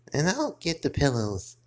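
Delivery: ordinary talk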